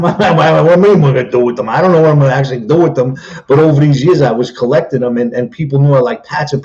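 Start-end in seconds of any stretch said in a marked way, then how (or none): 0.0-3.2 mock exasperated reply. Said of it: "So I'm".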